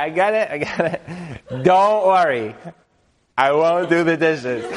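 mock dismissive assurance. Husband dismissively reassuring.

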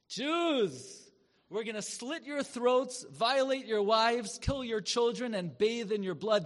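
high, loud threatening voice; menacing broadcast. Retold or imitated. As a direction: snarl the threat like a radio zealot.

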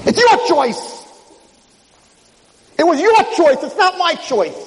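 high, forceful retort; high-pitched emphatic accusation; insistent angel. Retold or imitated. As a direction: throw the blame back at him. Angel hurls choice back at Chaim.

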